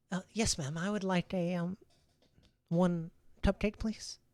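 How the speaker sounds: put-on high child ordering cupcake; little girl. GM voices child Vana ordering.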